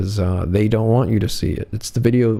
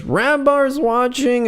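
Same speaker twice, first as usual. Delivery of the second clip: high, slow sing-song catchphrase; mock heckler; 13 semitones higher, slower than usual. Heckler calling out to the comedian, high slow mock chant. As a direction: drag the heckle out, much higher than normal. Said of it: "and he goes".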